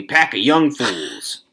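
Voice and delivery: slow, stout dismissal. A narrator's reading. Old man scorning the young people, stout. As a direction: dismiss them stoutly, stretched out. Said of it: "Old Man Warner said stoutly".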